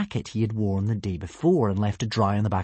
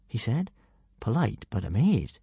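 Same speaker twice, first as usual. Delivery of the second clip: plain reading voice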